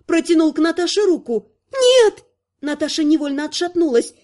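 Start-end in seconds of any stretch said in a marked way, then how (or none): 1.7-2.2 high, loud shouted warning; sudden high-pitched yell; warning cry. A narrator's reading. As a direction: cry out sharply to stop him. Warning cry as Ясон reaches out.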